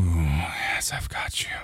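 soft reassuring whisper. Acted. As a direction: breathe it right in the ear. Comforting lover.

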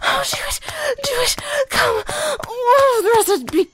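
high cries at the peak, near shouting; climax moans. Acted. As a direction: let go completely and cry out.

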